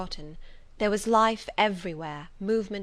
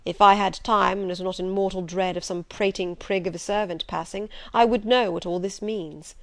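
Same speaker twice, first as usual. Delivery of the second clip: loud, forceful Rochester; commanding master; 6 dB louder than usual. Acted. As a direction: scold her firmly, then soften. Rochester lectures Jane, commanding.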